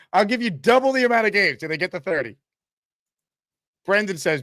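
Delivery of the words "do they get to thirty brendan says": usual speaking voice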